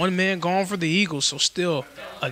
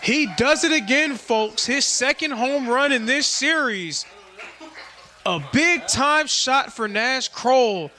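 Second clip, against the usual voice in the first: excited home-run call; 8 semitones higher, 3 dB louder than usual. Real, not acted. Announcer tells fans of Nash Kroll's home run, excited. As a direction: call it with rising thrill, stretching the big words.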